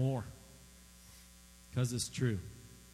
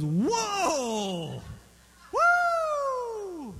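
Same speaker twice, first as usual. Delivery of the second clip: high, loud whoa and woo; hammed-up skunk ogling the cat; cartoon wolf whistle; 9 dB louder than usual. Preacher voicing Pepe ogling the cat, hammed-up whoops. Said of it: "he goes".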